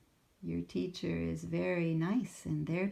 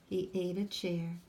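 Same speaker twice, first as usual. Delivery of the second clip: put-on reading to children; 4 semitones higher, 6 dB louder, slower than usual. A narrator's reading. Reads picture book aloud.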